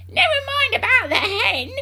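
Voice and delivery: high, loud, indignant screech; grandma shouting. A narrator's reading. Grandma on the roof jealous of the hen, shouting. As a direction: holler it very high, dragging out the end. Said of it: "Grandma shouted from the rooftop".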